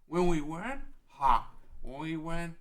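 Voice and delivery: loud, high burst of disbelief; a loud laughing scoff; 1 incredulous outburst. Acted. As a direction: shout it as if the idea is absurd. Darunia laughs, startled hope.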